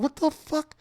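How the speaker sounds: very high what the fuck; stunned by the headstand on the rope, squeaking high; shocked outburst. Retold or imitated.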